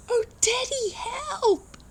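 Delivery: put-on tiny panicked kids calling their dad; frightened mole children. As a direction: squeal it like scared baby moles. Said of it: "Mole's children cried".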